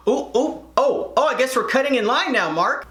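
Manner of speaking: high-pitched sarcastic taunt; mock indignation. Sarcastic challenge to line cutter.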